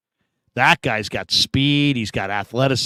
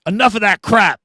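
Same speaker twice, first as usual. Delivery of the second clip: coach's voice; 6 semitones higher, 3 dB louder than usual. Retold or imitated. Coach scolding in retold story.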